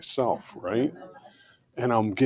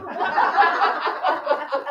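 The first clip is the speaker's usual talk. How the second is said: a burst of high, breathy laughter. Real, not acted. Driver laughing at how he tricked his own anger, high laugh.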